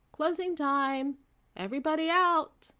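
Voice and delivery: loud, high announcement; a manager calling closing time. A narrator's reading. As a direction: call it out across the room. Manager calls out to customers.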